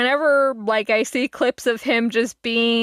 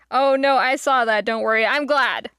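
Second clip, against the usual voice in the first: normal talking voice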